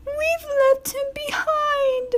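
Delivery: very high, slow sobbing coaches; high teary carriage sob. A narrator's reading. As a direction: weep it out together. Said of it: "sobbed Annie and Clarabelle together".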